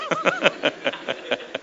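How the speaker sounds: soft breathy chuckle; laughing. Real, not acted. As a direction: chuckle at your own retort. Laughs after his own retort.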